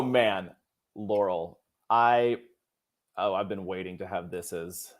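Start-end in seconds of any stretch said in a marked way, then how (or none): none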